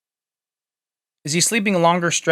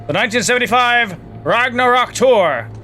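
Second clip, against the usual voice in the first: announcer; 6 semitones higher than usual. Acted. Reading tour poster announcer-style.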